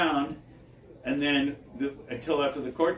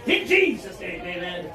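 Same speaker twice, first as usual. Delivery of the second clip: higher, louder amen to close; closing prayer; 4 semitones higher, 4 dB louder than usual. Retold or imitated. Pentecostal lady ending her prayer, higher and louder. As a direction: wrap the prayer up with fervor. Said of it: "she finishes up her prayer".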